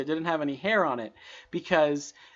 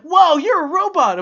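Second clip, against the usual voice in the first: put-on high, loud, amazed kid; awed kid voice; 11 semitones higher, 10 dB louder than usual. Imitating kids who think he is a robot, high and amazed. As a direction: play a wide-eyed kid marvelling.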